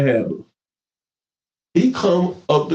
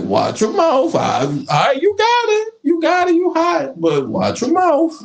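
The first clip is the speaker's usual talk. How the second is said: high-pitched scolding warning; 13 semitones higher than usual. Retold or imitated. Lecturing young player, high.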